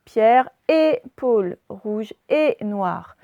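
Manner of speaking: "et" said slowly and closed, like é; slow teacher demo of "et" as é; example of et. Shown as a demonstration. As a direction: stretch out "Pierre et Paul" to show the é sound. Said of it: "ça se dit et".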